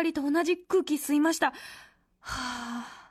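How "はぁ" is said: soft, low dreamy sigh; blissful sigh. Real, not acted. Fan who sat beside Ranka Lee, soft blissful sigh. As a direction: sigh softly like a smitten fan. Overcome at breathing the idol's air.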